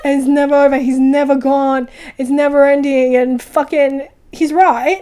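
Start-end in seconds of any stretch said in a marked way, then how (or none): 0.0-3.2 louder, ominous proclamation; louder, overdone spooky warning; dramatic declaration. Retold or imitated. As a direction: declare it like a horror villain. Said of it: "you don't have to be so dramatic".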